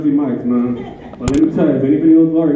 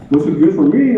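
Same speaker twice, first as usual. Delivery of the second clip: ordinary talk, nothing marked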